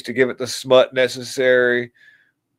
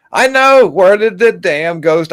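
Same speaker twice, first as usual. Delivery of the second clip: high, loud, slow mock wonder; mock gasp; 7 semitones higher, 6 dB louder, slower than usual. Mocking believers gushing over the ghost. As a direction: gush over the fake ghost.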